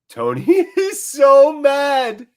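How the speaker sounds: high, loud and drawn out with a laugh; slow, high-pitched delighted disbelief; gleeful amazement. Real, not acted. Fan watching manager rage at referee, laughing high in amazement.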